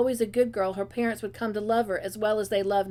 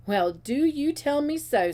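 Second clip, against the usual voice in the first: slow, higher-pitched mock wonder; sarcastic; 5 semitones higher than usual. A narrator's reading. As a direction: draw it out with pointed sarcasm. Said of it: "said Miss Cornelia sarcastically".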